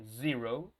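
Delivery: Zero with a buzzed Z, rising at the end; vibrating Z. Shown as a demonstration. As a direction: model Zero on a voiced Z. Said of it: "Tiene que existir esa vibración".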